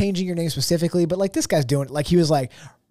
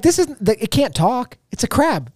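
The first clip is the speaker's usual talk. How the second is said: mock protest; 8 semitones higher, slower than usual. Acts out baffled viewer's protest.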